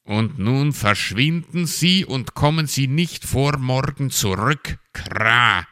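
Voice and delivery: strict squawked dismissal; stern raven. A narrator's reading. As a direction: order them off strictly, ending in a caw. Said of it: "forderte der Rabe in strengem Ton".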